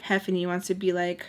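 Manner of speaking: usual speaking voice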